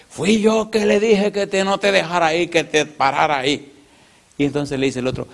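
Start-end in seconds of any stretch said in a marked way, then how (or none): 0.1-3.7 high, eager telltale voice; proudly owning up to the mischief; gossip's voice. Retold or imitated. The gossip tells the bandit he's the one who stopped him, high and eager.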